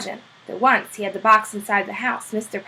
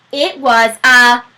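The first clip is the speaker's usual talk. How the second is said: slow, louder, wondering announcement; amazed reveal; 2 semitones higher, 6 dB louder, much slower than usual. A narrator's reading. Narrator reveals the penguin, slow and louder in awe. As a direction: stretch it out in delighted astonishment. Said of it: "not the slightest doubt".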